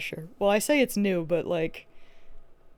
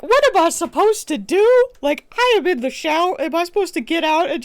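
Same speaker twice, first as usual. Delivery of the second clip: high, loud retold protest, easing off; exasperated rant that starts at a near yell; 5 semitones higher, 5 dB louder than usual. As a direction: protest loudly at the absurd demand. Retold exasperation at being interrupted showering.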